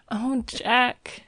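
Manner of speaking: put-on high-pitched cry from the girl; anxious cry. A narrator's reading. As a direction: cry out to him in mild alarm. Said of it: "she cried".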